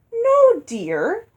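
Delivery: high, pouty "No, dear"; pouting. Acted. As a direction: sulk the words, pout audible. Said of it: "she pouted again".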